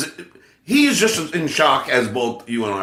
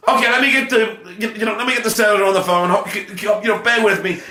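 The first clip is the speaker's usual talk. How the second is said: quick, raised fake Sean Moore accent; 5 semitones higher, faster than usual. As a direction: rush it in the Sean Moore accent, playing the assistant. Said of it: "I gotta be Sean Moore".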